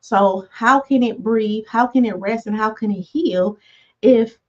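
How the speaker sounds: ordinary talk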